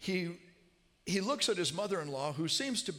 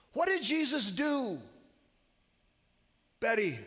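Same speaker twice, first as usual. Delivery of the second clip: loud, high question then her name; loud calling; 9 semitones higher, 5 dB louder than usual. Retold or imitated. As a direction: press her loudly for an answer. Calling to deaf mother-in-law.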